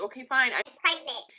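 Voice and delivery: retold exasperated confession. Admitting pregnancy to mom in car, loud exasperated blurt. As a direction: blurt it out loudly, fed up.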